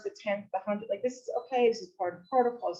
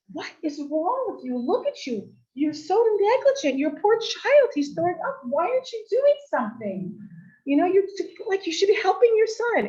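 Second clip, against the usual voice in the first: high, loud indignant scolding; yelling; 10 semitones higher, 5 dB louder than usual. Retold or imitated. Woman berating a mother before everyone, yelling. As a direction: yell accusingly at a negligent mother. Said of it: "She basically starts yelling".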